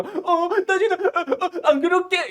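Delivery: high, laughing flustered promise; flustered boy. Retold or imitated. Boy flustered answering the girl, high laughing stammer. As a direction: stammer out a nervous yes. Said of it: "이렇게 되는 거죠".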